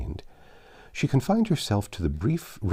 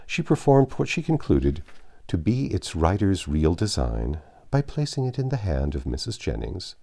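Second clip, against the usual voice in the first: ordinary delivery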